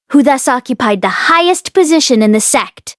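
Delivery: ordinary delivery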